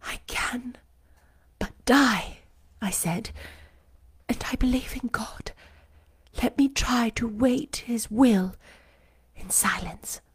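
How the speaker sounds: soft unvoiced whisper, resigned; whispered resignation. A narrator's reading. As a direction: breathe it out as a quiet prayer. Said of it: "These words I not only thought but uttered".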